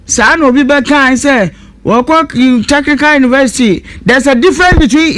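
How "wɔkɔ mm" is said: normal talking voice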